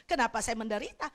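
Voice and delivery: ordinary delivery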